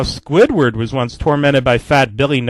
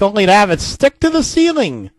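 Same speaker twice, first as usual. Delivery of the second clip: ordinary talk, nothing marked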